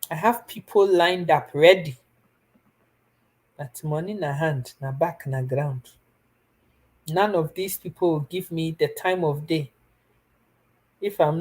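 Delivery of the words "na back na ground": ordinary talk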